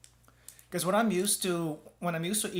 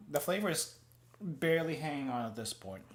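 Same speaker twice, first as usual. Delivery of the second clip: normal talking voice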